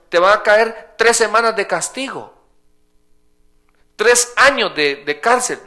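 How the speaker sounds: high, loud impassioned sermon; fiery preaching. Acted. Pastor preaching to his congregation about Paul, full force. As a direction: drive the point home to the congregation.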